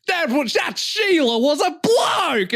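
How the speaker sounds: high, slow Australian shout; loud shout. Retold or imitated. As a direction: bellow it across the bar. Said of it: "and he's like".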